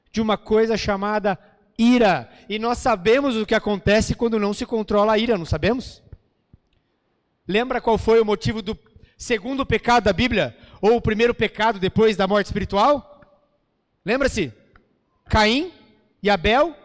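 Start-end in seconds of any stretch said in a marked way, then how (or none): none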